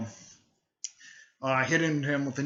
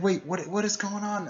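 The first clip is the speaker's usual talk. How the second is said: higher-pitched puzzled question; mildly alarmed, higher voice; confused; 7 semitones higher than usual. Retold or imitated. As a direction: voice a bewildered girl, pitched up. Acting out confused girl in story.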